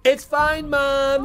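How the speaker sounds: high, put-on kid's reply; imitation child voice. Frog impersonating the child to mom, high fake voice. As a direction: call back as if you were the child.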